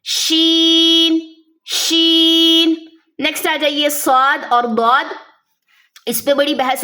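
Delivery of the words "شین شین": شین held long and slow with spreading ش and ringing ن; chanted شین; drawn-out letter name. Shown as a demonstration. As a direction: stretch شین, end on a clear ن. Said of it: "آخر میں نون کی ساؤنڈ آنی چاہیے".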